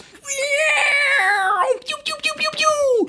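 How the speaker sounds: high-pitched pew pew laser sounds; Star Wars blaster noises. Shown as a demonstration. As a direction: fire a toy blaster with your mouth. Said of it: "I can do Star Wars noises".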